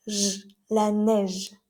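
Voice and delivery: "J, la neige" slow, with the j as a buzzing voiced sh; slow teacher demo, "neige" with a voiced zh. Shown as a demonstration. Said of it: "sh, pero la hacemos sonora".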